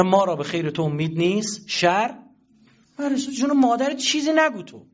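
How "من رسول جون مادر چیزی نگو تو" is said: higher, louder mock plea to stay silent; pleading rebuke. Telling a candidate to say nothing, raised mock pleading. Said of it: "گفتم".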